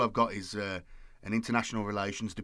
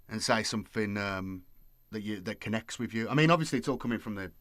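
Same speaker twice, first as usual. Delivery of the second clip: normal talking voice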